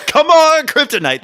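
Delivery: sudden loud shouted quote; loud mock movie line. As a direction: belt it out like the scene. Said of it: "makes me laugh".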